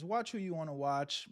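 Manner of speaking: normal talking voice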